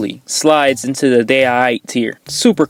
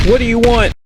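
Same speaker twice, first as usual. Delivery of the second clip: high frightened call into the dark; scared shout; 9 semitones higher than usual. Acted. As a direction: yell at them, terrified. Confronting the intruders.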